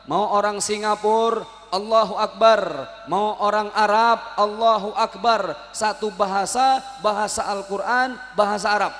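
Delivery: ordinary talk, nothing marked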